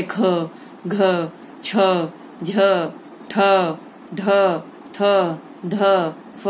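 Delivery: ख, घ, छ, झ each released with a strong puff of air; strongly aspirated consonants. Shown as a demonstration. Teacher demonstrates aspirates to pupils, ख, घ, छ, झ separate and breath-heavy. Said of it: "श्वास वायु अधिक मात्रा और वेग से निकलती".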